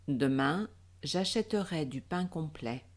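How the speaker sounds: usual speaking voice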